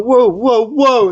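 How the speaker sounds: high, loud alarmed whoas. Retold or imitated. Shouting at the men hooking up the caravan, high alarmed. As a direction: stop them in panic.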